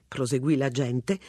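ordinary delivery

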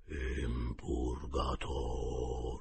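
very slow, low ghostly breath; thread of voice. A narrator's reading. Boy faking the dead uncle's spirit, faint slow ghost voice. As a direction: answer like a faint ghost from beyond. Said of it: "risposi con un fil di voce".

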